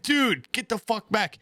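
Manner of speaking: high, urgent plea to back off; high exasperated telling the sick friend to back off. Retold or imitated.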